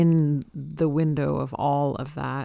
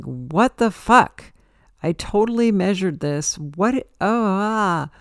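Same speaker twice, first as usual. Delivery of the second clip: higher, louder baffled cursing at a mistake; exasperated outburst; 5 semitones higher, 5 dB louder than usual. Retold or imitated. Retelling her attic mistakes, a frustrated outburst. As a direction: blurt it out, stunned and fed up.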